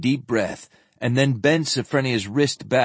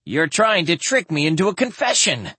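high, alarmed accusation; accusing; 8 semitones higher than usual. Acted. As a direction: accuse them in rising panic. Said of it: "the doctor accused".

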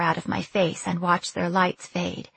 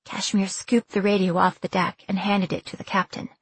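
ordinary delivery

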